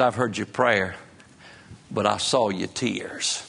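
low, gentle, measured quote; tender reassurance. Retold or imitated. Preacher quoting God answering a weeping king, tender reassurance.

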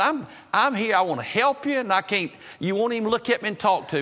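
high-pitched, urgent pleading; exasperated appeal. Retold or imitated. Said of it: "I was about half mad at him".

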